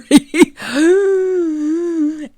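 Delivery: long, high-pitched mock cry; loud, high play-acted whine; mock-upset wail. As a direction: wail in pretend anguish, high and drawn out. Mock upset at unplugged mic.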